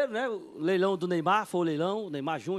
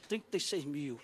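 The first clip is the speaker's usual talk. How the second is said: low, soft, sobered voice; acted quiet shock; stunned sum; 8 semitones lower, 9 dB quieter, slower than usual. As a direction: repeat the amount as it sinks in. Realising what he has spent.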